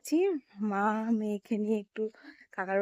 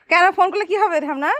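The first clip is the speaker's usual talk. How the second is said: loud, high shout to someone at a distance; calling out; 9 semitones higher, 9 dB louder than usual. Real, not acted. Shouting back across the garden to the relative, loud and high. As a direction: keep calling across the yard, raised and high.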